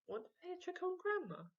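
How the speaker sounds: lightly voiced sneaky boy, quick and high; sly kid voice. Henry to his brother, a lightly voiced quick scheming boy.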